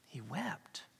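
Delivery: a breathy whisper of the verse. Acted. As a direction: whisper it like a secret grief. Repeating the verse for weight.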